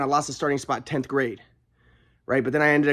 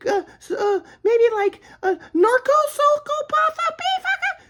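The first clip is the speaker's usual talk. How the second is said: much higher, mocking know-it-all voice; mocking onlookers; slower than usual. Mocking people who speculate about his family, high falsetto. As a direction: parody people guessing at the cause. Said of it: "people are gonna be like".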